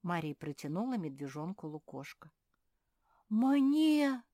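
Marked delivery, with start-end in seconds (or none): 3.3-4.2 high, loud, drawn-out amazement; astonished little bear; amazed gasp. A narrator's reading. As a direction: gasp the word out in delight. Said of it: "ахнул Фил".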